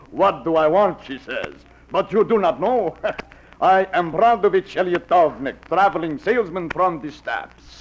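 fake Russian accent. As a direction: play a fake Russian peddler at the door. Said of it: "like the accent, the beard".

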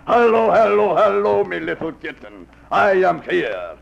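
fake Russian accent. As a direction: play a fake Russian peddler at the door. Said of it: "like the accent, the beard".

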